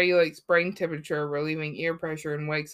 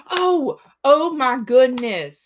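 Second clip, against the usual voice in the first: sudden realization; 7 semitones higher, 9 dB louder, slower than usual. Real, not acted. Suddenly remembers forgotten topic.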